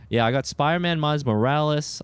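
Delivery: ordinary delivery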